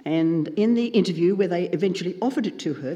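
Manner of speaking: ordinary talk, nothing marked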